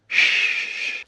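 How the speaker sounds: "sh" as a long voiceless hushing consonant; sh sound. Shown as a demonstration. Teacher demonstrating a phonetic symbol, isolated sh sound. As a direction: hold "sh" alone, no vowel after it. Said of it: "this is a symbol of the sound".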